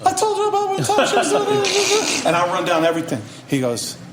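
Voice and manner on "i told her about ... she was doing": squeaky, panicked mock falsetto; ten octaves up. Scared rapper explaining himself to the boss, high squeal. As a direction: imitate yourself squealing out an explanation. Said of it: "my voice goes up about ten octaves".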